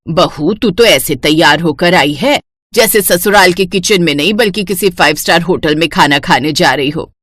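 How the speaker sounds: low, bossy mother-in-law voice; a domineering saas giving orders; stern mother-in-law. Acted.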